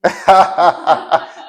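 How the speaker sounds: real amused laugh; loud laugh. Real, not acted. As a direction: burst of high loud laughter. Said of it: "laughs at student remark".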